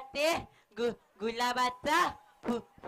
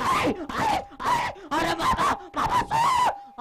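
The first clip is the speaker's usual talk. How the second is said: much higher, loud wailing shout for mother; desperate scream; 4 dB louder than usual. Acted. Child pulled from his mother screams for her, wailing. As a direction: scream as if torn from her arms.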